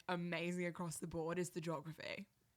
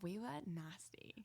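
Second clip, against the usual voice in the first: whispered, softer and slow; guilty whisper; 9 dB quieter, slower than usual. Real, not acted. British guest admits colonial past to American host, in a whisper. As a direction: confess it under your breath, a little ashamed.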